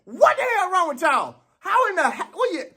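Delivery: high, slow, disbelieving yell at the track folks; can't believe what you're seeing, raise it high and drag it out; incredulous outburst. Retold or imitated. Scolding the track athletes in high, slow disbelief. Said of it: "looking at y'all track folks like".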